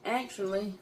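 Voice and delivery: normal talking voice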